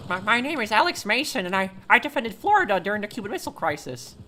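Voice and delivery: put-on high, wavering old man. Shown as a demonstration. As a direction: croak it out like a grandpa. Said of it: "Can you do your old man voice impression?".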